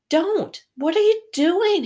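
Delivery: high, urgent cry of alarm; Harry urgently stopping the elf, high and alarmed; alarmed hiss. A narrator's reading. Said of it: "Harry hissed".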